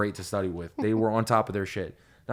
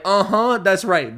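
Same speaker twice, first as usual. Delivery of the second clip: loud, high self-congratulation; smug victory boast; 9 semitones higher, 8 dB louder, slower than usual. Retold or imitated. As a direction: gloat like you just dropped the mic. Smug victory reply after mic drop.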